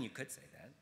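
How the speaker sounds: usual speaking voice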